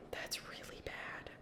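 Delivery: unvoiced whisper. Real, not acted. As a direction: whisper it in dread. Aside about the pact.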